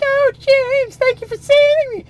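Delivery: very high grateful swoon; swooning girl. Acted. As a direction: gush like a rescued damsel. Abby thanks her rescuer.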